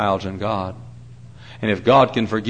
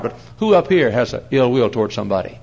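usual speaking voice

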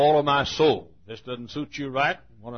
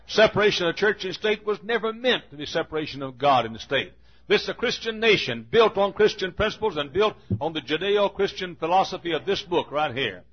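higher, rising preacher's fervor; fiery preaching; 6 semitones higher than usual. Acted. Pastor rails at the congregation in a fiery higher voice. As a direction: preach it with mounting fire.

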